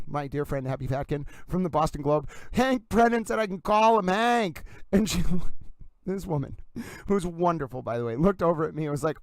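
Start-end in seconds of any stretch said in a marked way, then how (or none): 2.5-4.6 high, slow, gleeful proclamation; beaming slow gush of pride; giddy announcement. Retold or imitated. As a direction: announce it like a proud child. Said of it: "I was like".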